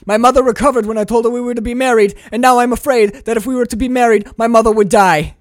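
acted-out urgent explanation, picking up volume; hurried mock confession. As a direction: rattle off a melodramatic confession. Acted retelling of broken engagement.

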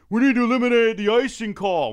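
high, loud overeager answer; mock eager contestants. Other auditioners answering the hockey question, mock overeager shout. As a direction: call it out like an audition hopeful showing off. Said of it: "And everyone's like".

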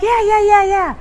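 mock the neighbor waving her off; dismissive neighbor. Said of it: "And he's like".

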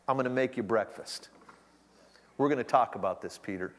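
a quiet promise to a friend; tender reassurance. Acted. Jesus speaking tenderly to Peter, low and soft.

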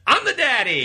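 high, loud mock brag; hammed-up triumphant shout; boasting shout. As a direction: yell it like a proud show guest. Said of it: "he's just like".

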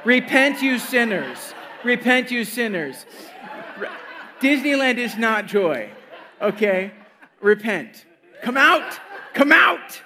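loud, laughing mock hellfire preacher; fanatical zeal. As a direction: shout it like a street preacher. Mock street preacher shouting.

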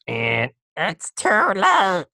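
badly faked digital voice; terrible computer impression. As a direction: drag it out like a clunky satanic computer. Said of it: "That's a terrible impression of it".

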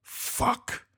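breathy under-the-breath curse; muttered curse. A narrator's reading. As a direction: swear to himself quietly. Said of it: "Casper muttered".

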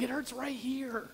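small, hushed kid imitation; young son. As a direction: imitate a child quietly showing where it hurts. Voicing his seven-year-old son.

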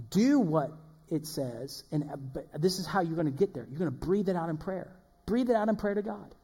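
usual speaking voice

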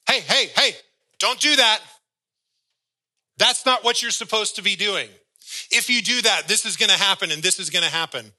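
high, loud warning voice; scolding Holy Spirit. Acted. Holy Spirit warns the believer off sin, high loud scolding. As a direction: call out sharply like a parent stopping a child.

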